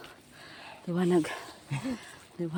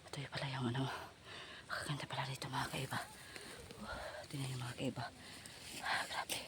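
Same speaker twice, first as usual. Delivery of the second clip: very soft, low murmur, partly whispered; hushed aside; 5 semitones lower, 13 dB quieter than usual. Real, not acted. Vlogger showing viewers the pretty spot, hushed. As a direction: keep it under the breath, low.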